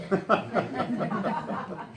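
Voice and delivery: breathy burst of laughter. Real, not acted. Narrator laughing at her own line. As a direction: laugh out at the joke.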